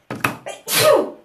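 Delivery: a sudden loud real sneeze; sneezing. Real, not acted. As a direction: let out a full sneeze. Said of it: "I'm going to sneeze".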